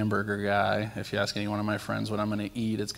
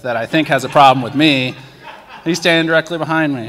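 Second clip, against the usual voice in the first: louder, higher, laughing through the words; 5 semitones higher, 13 dB louder than usual. Real, not acted. Man laughing as he tells the congregation who was behind him. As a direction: laugh out the punchline.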